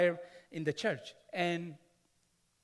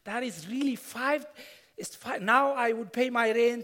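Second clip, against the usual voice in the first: rising joy, raised and louder; excited; 5 semitones higher, 7 dB louder than usual. Real, not acted. A pastor celebrating the income that paid his rent, excited. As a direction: burst out with real relief and delight.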